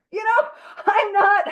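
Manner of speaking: high laughing cry breaking in; laughing outburst. Real, not acted. Confessing bad work habits to the group, laughing loudly.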